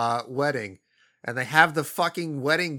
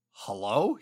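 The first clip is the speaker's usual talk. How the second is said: mock confused hello; 6 semitones lower, 3 dB quieter than usual. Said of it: "he's like".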